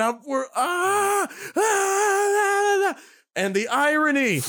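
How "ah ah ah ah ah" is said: high, sustained mock scream; long celebratory wail held on one high note; reenacted squeal at the winning shot; fan scream. Reenacts screaming at the game winner.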